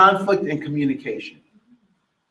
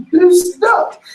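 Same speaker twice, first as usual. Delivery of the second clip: much higher, louder whine; hammed-up daughter's complaint; mock child's wail; 7 dB louder than usual. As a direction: whine it like a teary toddler.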